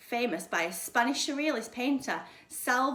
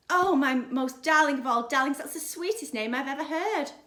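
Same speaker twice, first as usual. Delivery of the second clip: high, loud, sugary gushing; a little girl cooing over her new kitten, raised and loud; gushing sweetly; 4 semitones higher, 6 dB louder than usual. Acted. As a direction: gush over the kitten like a doting child.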